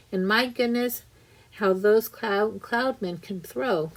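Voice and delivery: ordinary delivery, nothing marked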